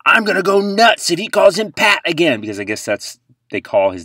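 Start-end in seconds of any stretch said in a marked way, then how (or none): 0.0-2.4 high, loud mock complaint; imitating mom. Host mocking Mahomes' mom's tweet, high loud whine. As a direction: mimic her annoyed tweet.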